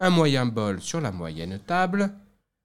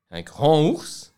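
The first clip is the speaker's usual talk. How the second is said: louder and slow, to sound huge; big bear announced; 5 semitones higher, 9 dB louder, slower than usual. A narrator's reading. Narrator booms the big bear's entrance, louder and slow. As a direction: make "Un grand ours" loud and slow.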